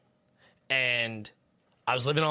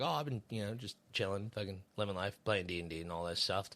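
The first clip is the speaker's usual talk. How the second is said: quiet; 4 semitones lower, 10 dB quieter than usual. Retold or imitated. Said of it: "I was like".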